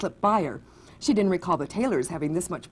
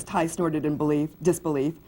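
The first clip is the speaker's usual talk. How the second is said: ordinary delivery, nothing marked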